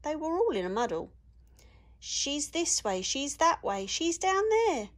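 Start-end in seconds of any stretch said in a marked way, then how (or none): 2.0-4.9 high, jumbled piggy voices all talking over each other; high piggy squabble; squeaky pigs arguing. Acted. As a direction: play three muddled little pigs pointing every which way. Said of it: "said, they said".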